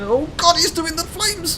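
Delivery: very high, loud cry of alarm; panicked yell. Real, not acted. Player as the dragon starts its flames, panicked yell. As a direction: yell it high as the attack hits. Dragon suddenly breathes fire.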